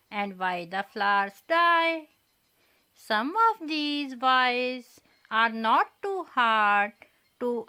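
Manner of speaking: slow, measured recital with each why lifted; poem recitation. Shown as a demonstration. Teacher reciting the poem Why to the children, slow and measured. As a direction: recite it line by line, slowly and deliberately, for young pupils. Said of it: "Let us recite the poem Why".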